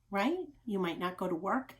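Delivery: usual speaking voice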